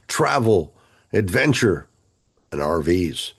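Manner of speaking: ordinary talk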